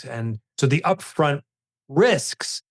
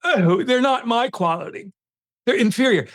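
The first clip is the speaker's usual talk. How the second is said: snooty; 9 semitones higher than usual. Retold or imitated. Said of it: "all snooty".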